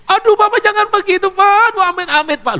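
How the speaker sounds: high-pitched alarmed student; pleading student. Retold or imitated. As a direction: beg him to stop like a scared student.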